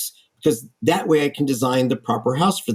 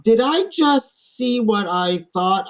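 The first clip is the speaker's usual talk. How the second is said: stunned disbelief; 7 semitones higher, slower than usual. Retold or imitated. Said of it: "I'm thinking to myself".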